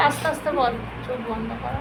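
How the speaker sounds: fading to a soft hush; hushed coaxing during the exorcism. Real, not acted. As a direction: coax him gently, dropping to near silence. Coaxing the possessed boy.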